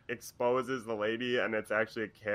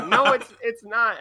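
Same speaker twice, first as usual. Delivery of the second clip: high, loud imitated lady; mock-defensive protest, pitched way up; flustered denial; 12 semitones higher, 8 dB louder than usual. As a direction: deny it hastily in a raised put-on voice. Woman denying it in retold argument.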